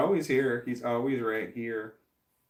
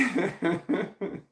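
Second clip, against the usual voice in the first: laugh; 8 semitones higher than usual. Real, not acted. Reacting to puppy watching.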